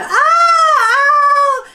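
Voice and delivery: long, high screaming roar. A narrator's reading. Giant roaring in his nightmare, long loud howl. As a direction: howl loudly and hold it. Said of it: "roared the flesh lump eater".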